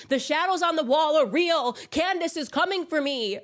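high, loud mocking cry of paranoia; mock paranoid wail. Mocking the accuser she fights in court, high and loud. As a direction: wail it as a parody of a frightened accuser.

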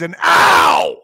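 sudden low yelp of pain; real cry of pain as bitten. Real, not acted. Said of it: "That hurt".

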